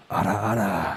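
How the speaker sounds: low teasing anime voice; low ara ara. Retold or imitated. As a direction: drop low and tease. Imitating the anime girl.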